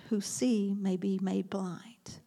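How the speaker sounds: slow whisper; slow the ending into a whisper; hushed. Acted. Jesus warning about blindness, drops into slow whisper.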